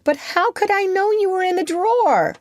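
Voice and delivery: very high, defensive question; exasperated high-voiced comeback; protesting retort. A narrator's reading. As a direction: protest innocence, pitch soaring. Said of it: "Peter retorted".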